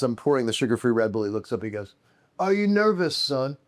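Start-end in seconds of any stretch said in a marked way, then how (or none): 2.4-3.6 [name]. Retold or imitated. Imitating the guest's teasing question.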